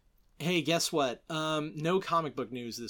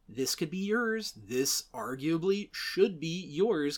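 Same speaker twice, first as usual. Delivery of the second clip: normal talking voice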